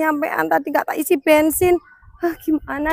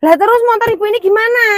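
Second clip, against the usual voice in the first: exasperated; 7 semitones higher, 5 dB louder, much faster than usual. Acted. Wife protests husband's rudeness.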